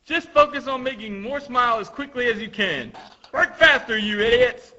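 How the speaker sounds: mock-furious high scolding; angry overseer. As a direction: berate the workers, building to a shout. Acted overseer berating workers.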